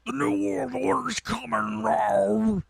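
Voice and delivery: Alex Jones impression. To the caller, mock Alex Jones ranting. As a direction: ham up a doomsday talk-show host. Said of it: "that's my Alex Jones".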